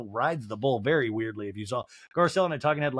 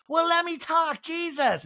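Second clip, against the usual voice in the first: high-pitched exasperated mock yell; retold scream, high and put-on; slower than usual. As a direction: imitate a frustrated outburst, pitched way up. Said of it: "And Kyle screams".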